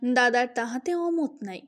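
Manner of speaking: ordinary delivery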